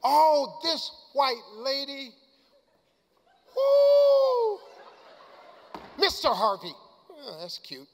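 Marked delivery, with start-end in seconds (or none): 3.6-4.6 falsetto whoop; high loud woo. Real, not acted. Cheering back at the stomping crowd, loud whoop.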